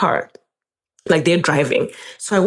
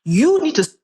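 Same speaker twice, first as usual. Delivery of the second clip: indignant; 9 semitones higher than usual. Retold or imitated. Confronting boyfriend about his tone.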